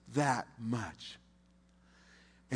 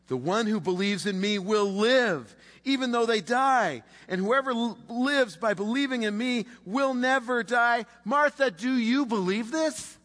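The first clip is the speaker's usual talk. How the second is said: impassioned Jesus; 7 semitones higher, 5 dB louder than usual. Acted. Preacher voicing Jesus to Martha.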